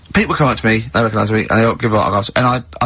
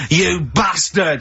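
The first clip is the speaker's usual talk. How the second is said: imitated angry yell, long drawn-out and higher; mock furious shout; 4 semitones higher, much slower than usual. Woman turning on comedian in pub, mock-outraged shout. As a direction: stretch out the insult as a retold shout.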